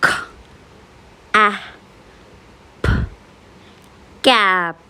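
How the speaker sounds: k, a, p, then blended into cap; sounding out words. Shown as a demonstration. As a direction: say each letter sound with pauses, then blend. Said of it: "Let's practice and blend the sounds".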